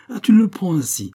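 high, loud exasperated outburst; acted fury at full voice; vociferating. A narrator's reading. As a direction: cry out in outraged disbelief. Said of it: "vociféra ce dernier".

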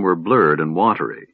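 ordinary delivery, nothing marked

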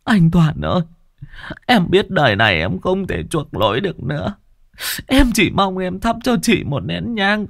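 high, tearful entreaty; desperate begging. Acted. Frightened woman kneeling before her brother-in-law, desperately begging. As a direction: plead on your knees, voice pitched high. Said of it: "Bà Loan quỳ xuống van xin".

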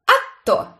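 high, loud smug reply; smirking. A narrator's reading. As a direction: say it with a grin. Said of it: "ухмыльнулась Брит".

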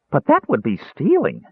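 high, scandalized kender; high-pitched dismay; shocked. A narrator's reading. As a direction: protest it in innocent shock.

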